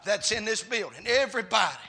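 loud, driving preacher's cadence; full-force preaching. Real, not acted. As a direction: preach it in punched, shouted phrases.

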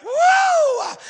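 very high, loud sustained "woo"; piercing pulpit whoop of joy; exultant whoop. Real, not acted. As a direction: let out a long revival holler. Preacher whoops at sermon climax.

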